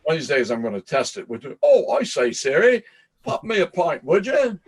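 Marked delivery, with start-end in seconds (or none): 1.6-4.6 high, lilting mock British Oh, I say, Siri; a hammed-up posh-English request to Siri; mock British accent. Testing his phone assistant with a joke British accent, high. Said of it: "I think that's Cockney".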